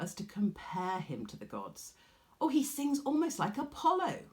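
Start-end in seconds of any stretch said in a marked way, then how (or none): 2.4-4.2 admiring gush. Acted. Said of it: "you might say".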